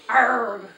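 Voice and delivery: loud angry snarl; snarled arg. Acted. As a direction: snarl like a beast. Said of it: "Mister Nye snarled".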